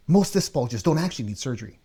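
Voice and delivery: fast, eager delivery. Shown as a demonstration. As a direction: rush through it with energy. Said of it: "Let me say this sa-, fast".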